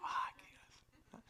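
whispered wry remark; whispered aside. Retold or imitated. Pastor whispering at the pulpit, amused. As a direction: breathe it out dryly.

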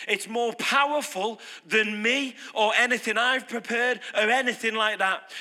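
normal talking voice

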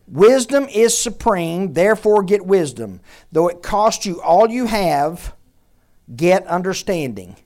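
slow, loud solemn verse reading; scripture reading. Acted. Preacher reading the key verse aloud, solemn and slow. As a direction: read it out like holy writ.

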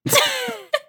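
squeaky giggle; a high giggling burst; high burst of laughter. Real, not acted. Laughs at the understatement.

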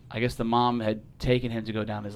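normal talking voice

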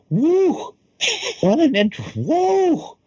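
excited. Real, not acted. Hyped by the trailer intro.

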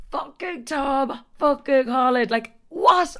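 high, wavering gush; giddy disbelief. Real, not acted. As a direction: swoon over the impossible luck. Swooning disbelief over crush.

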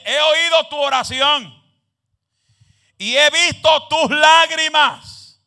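slow, high, loud preaching; full-force scripture proclamation. Real, not acted. Preacher quoting God's answer to the congregation, slow loud proclamation.

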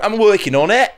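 high-pitched put-on British accent; mock British accent. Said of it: "your [name] accent".

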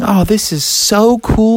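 slow, high showing-off delight; thrilled kid, savoring it; Froggy. Acted. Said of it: "He was saying".

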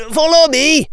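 loud, very high-pitched order. A narrator's reading. Prefect rallying his house, loud high voice. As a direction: pipe up loudly, way up high. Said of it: "he said in a high voice".

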